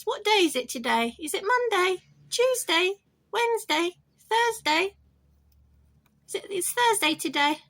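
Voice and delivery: high, loud sing-song questioning; talking to pet bird. Pretend. Teaching a pet bird the days, high sing-song.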